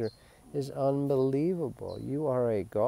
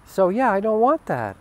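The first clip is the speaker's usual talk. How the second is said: normal talking voice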